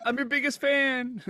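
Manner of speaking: high, loud fan squeal; hammed-up high-pitched adoration; mock gushing fan. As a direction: gush like an over-the-top superfan.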